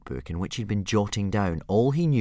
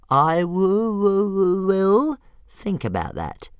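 much higher, slow, stuttering dragon voice; little dragon considering; 13 semitones higher, 8 dB louder, slower than usual. Acted. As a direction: muse aloud like a tempted pet. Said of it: "Toothless voice".

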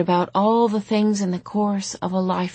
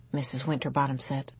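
ordinary delivery, nothing marked

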